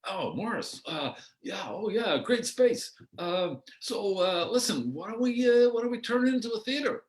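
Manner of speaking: put-on high, bright, gushing young enthusiast; breathless, eager let's-do-a-show voice; eager young Andy Hardy. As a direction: pitch the big idea like a keen kid. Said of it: "I'm the Andy Hardy from, from Canada".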